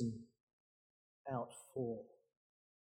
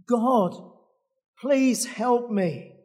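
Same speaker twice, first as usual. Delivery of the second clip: higher, slow plea; pleading; 6 semitones higher, slower than usual. Acted. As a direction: beg God for help. Jack pleads with God.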